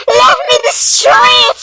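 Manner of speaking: high, frantic scream. Acted. Kid screams at the asymmetrical tree, frantic. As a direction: shriek it in panic. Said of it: "he screamed".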